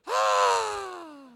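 put-on high delighted squeal of a tossed child; child's squeal. Grandfather imitating grandson tossed in the air, high squeal. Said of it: "he goes".

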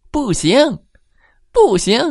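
母老鼠嚷嚷; mother mouse yelling. A narrator's reading. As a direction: shout down the timid man as a mouse. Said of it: "又冲着胆小先生嚷嚷道".

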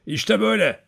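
lightly voiced old man showing how. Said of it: "ulumaya başladı".

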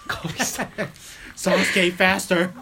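high, drawn-out, slow begging; hammed-up lover, slow; mock moaning plea. Friends teasing Steven, slow mock lover plea.

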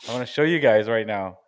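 normal talking voice

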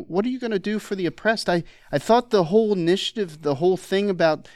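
put-on high, quick Canadian accent; imitating Jordan Peterson's Canadian accent. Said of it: "I can't do a Canadian accent very well".

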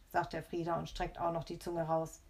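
ordinary delivery, nothing marked

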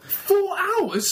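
high incredulous question; disbelieving, pitch jumps up; surprised. Real, not acted. Incredulous at four-hour bus tour.

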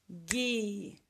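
"Guisado" as gui, sa, do with long gaps; syllable by syllable. Shown as a demonstration. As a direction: stretch each of the three syllables. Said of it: "Separa as sílabas".

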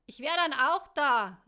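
big, high shout down the hallway; calling out. A narrator's reading. Man announcing his arrival through the hall, calling out. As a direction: holler it through the house. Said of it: "rief er durch den Flur".

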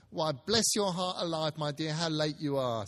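a mother asking where everyone is; Mrs Cratchit's voice. Acted. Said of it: "said Missus Cratchit".

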